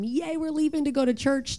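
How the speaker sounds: put-on a child chirping about leaving for church; mock happy kid. Daughter's happy face retold.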